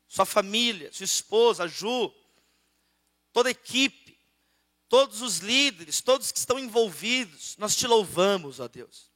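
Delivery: earnest congregational prayer. Acted. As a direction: pray over the church, steady and fervent. Opening prayer over music. (oração fervorosa)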